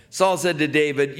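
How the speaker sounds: normal talking voice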